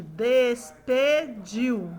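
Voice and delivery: DESPEDIU very slow, des-pe-diu; deliberate DES, PE, DIU with DIU in one sound; slow syllable by syllable. Shown as a demonstration. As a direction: stretch "Despediu" into three separate beats. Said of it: "Vamos falar pausadamente".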